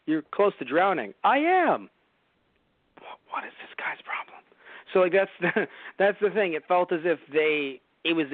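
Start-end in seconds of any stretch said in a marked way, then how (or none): 1.2-1.9 high, slow goofy dinosaur; high silly dinosaur voice. Pretend. Acted dinosaur answer. 3.0-4.4 whispered aside. Pretend.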